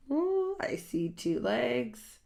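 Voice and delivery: ordinary delivery, nothing marked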